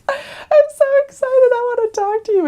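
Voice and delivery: high, loud, slowed delight; gushing old lady. Retold or imitated. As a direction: beam it out like a sweet grandma. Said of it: "She's like".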